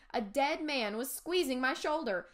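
ordinary delivery

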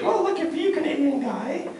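high put-on woman's voice; Swedish woman. Comedian voicing his Swedish ex, high and teasing. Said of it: "she was like".

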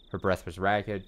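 ordinary delivery

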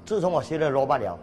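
ordinary delivery

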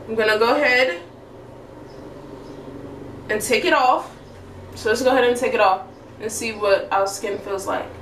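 talking through mask opening; wearing a sheet face mask. Real, not acted. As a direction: face covered by wet sheet. Seen 3: Sheet mask covers face while talking; mouth hole leaves lips free.

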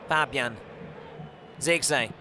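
usual speaking voice

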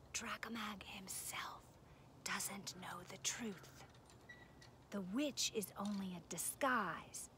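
slow, secretive whisper. A narrator's reading. Zog confiding the master's secret in Dakin's ear, whispered. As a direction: breathe the secret slowly into an ear. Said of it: "to her ear and whispered".

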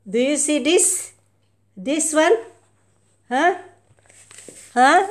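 loud taunting questions; loud teasing. Real, not acted. Owner loudly teasing her cat with something.

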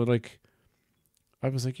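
ordinary delivery

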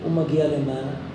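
usual speaking voice

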